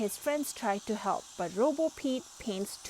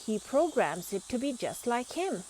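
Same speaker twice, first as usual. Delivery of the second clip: ordinary delivery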